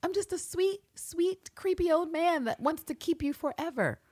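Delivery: very high, soft simpering voice; creepy old man playing sweet. Creepy old man apologising to Villanelle, simpering.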